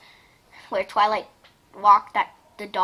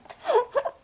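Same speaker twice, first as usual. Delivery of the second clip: high squeal laugh; 13 semitones higher, 5 dB quieter than usual. Real, not acted. Laughing at story.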